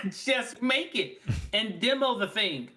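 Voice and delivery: high, slow, laughing plea. Real, not acted. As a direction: laugh it out, pleading. Laughing while urging demos.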